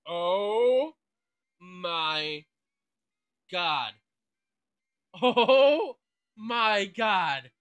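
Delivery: loud, drawn-out disbelief; real booming astonishment, each word separated; stunned excitement. Real, not acted. Player stumbles on a favorite starter, stunned and excited.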